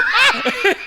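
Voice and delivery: startled shriek breaking into a laugh; high laughing shriek. Retold or imitated. Guest shrieking at the angry bride, high laughing yelp. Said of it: "And I go".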